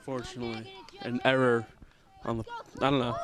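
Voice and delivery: ordinary talk, nothing marked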